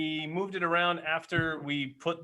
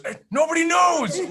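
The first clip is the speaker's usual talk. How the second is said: high, loud carefree yell; a loud, careless cry; mock shout; 9 dB louder than usual. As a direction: shout it like nobody will ever find out. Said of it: "So my initial reaction is".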